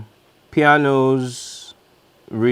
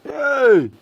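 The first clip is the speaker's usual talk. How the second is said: startled self-correction; 6 semitones higher than usual. Real, not acted. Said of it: "What the hell is pronounce that emphasis?".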